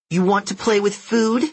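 put-on high, nasal, challenging kid; bossy nasal girl. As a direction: dare them like a playmate. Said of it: "bossy nasal voice".

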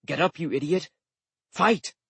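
impatient grunted order, rising on the last word. A narrator's reading. Gorilla ordering a fallen friend to fight, impatient. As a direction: urge them on in a gruntlike character voice. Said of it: "He grunted at me impatiently".

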